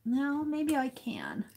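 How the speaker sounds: whispered aside to herself. Real, not acted. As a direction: breathe it out, no voice. Whispered aside while carving.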